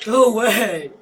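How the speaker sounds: loud high shout at the monster; defiant shout. Real, not acted. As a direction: yell to chase it off. Shooing monster in game.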